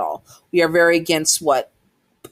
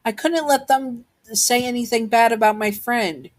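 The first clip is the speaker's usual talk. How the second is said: put-on high-pitched pleading child; acted child standing up for a friend; earnest protest; 7 semitones higher than usual. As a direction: justify yourself in a raised, earnest voice. Child explains fight to mother.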